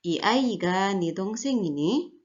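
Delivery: slow Korean question, lifting at "ini"; rising question ending. Shown as a demonstration. Teacher reading the first example sentence, rising question ending. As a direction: draw it out and raise the final "ini". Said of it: "intonasinya agak menaik".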